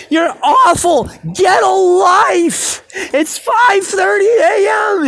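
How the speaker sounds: high, loud, slow mock rant; retold angry yell. Woken neighbor complaining about the time from his window, slow high mock yell. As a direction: stretch each complaint out in a heckling yell. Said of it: "He's like".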